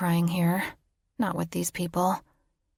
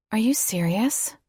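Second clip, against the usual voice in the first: ordinary delivery, nothing marked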